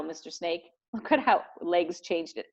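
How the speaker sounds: usual speaking voice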